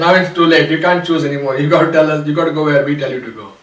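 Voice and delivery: loud, fast, insistent telling-off; scolding. Acted. Gods scolding stuck Trishanku for his crazy idea, loud and fast.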